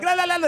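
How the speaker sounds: very high la-la jabbering; imitated angry gibberish. Host imitating a manager cursing in his language, high gibberish.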